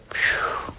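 breathy whispered phew of amazement; soft astonished phew; whistled phew. Real, not acted. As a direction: blow out air at the huge number. Reaction to the number.

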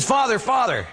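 high-pitched eager call; retold breathless-to-catch-you hail, pitched high; urgent calling out. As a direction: imitate a kid running up to get attention. Kid runs up calling the priest.

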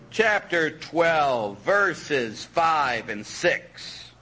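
very slow, drawn-out announcement; deliberate citation. Real, not acted. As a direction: stretch out each word of the verse reference.

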